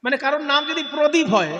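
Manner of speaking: ordinary delivery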